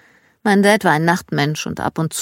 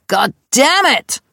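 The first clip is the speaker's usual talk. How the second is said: slow, higher, louder imitated curse; mock curse; 8 semitones higher, 4 dB louder, slower than usual. Dad cursing from his room down the hall, imitated yell. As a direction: drag it out like an angry dad.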